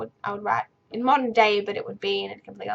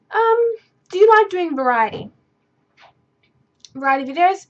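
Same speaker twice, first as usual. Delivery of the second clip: reading question; 8 semitones higher, 8 dB louder than usual. Acted. Reading a viewer question aloud.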